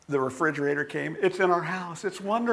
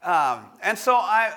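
ordinary delivery

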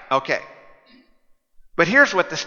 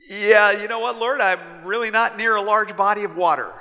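Noah objecting; 5 semitones higher than usual. Acted. Noah voiced objecting to God.

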